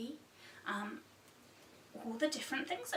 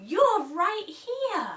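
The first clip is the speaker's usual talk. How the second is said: delighted reveal; 9 semitones higher, 10 dB louder, much slower than usual. Shown as a demonstration. Pointing at child, storybook reveal.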